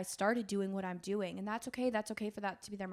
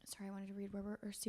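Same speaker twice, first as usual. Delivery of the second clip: whisper; 12 dB quieter, much faster than usual. Real, not acted. Aside while finding her place.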